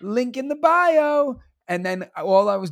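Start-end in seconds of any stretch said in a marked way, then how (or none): 0.0-1.4 high, perky social-media host voice; chirpy vlogger pitching his favorite pen; influencer voice. Acted. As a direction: sell it like a bubbly lifestyle influencer. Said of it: "teacher influencer life videos".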